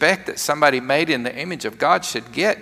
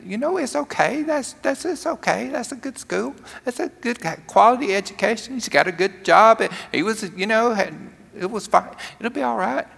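soothing reassurance; 8 semitones higher than usual. Retold or imitated. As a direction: gently talk her down, pitched up. Pastor comforts the upset woman.

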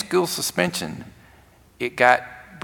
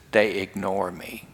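put-on soft, low, subdued retelling of a hurt girl; understated retold sadness; tearful confession; 5 semitones lower, 8 dB quieter than usual. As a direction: confess it quietly, voice dropped. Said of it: "she's got tears running down".

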